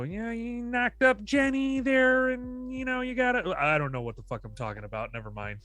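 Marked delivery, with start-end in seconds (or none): 0.0-3.5 guidance counselor voice. Retold or imitated. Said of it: "It's usually your guidance counselor that's like".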